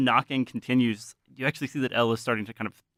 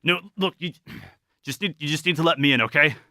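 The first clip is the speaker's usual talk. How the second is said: raised, higher urgent voice; insistent pleading; 5 semitones higher, 5 dB louder, faster than usual. Acted. Carl asking to be let in, insistent. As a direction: press them to open up, getting louder.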